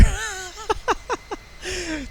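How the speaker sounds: shrill-pitched burst of laughter; high squeaky laugh. Real, not acted. Reacts to Vibe Star's joke.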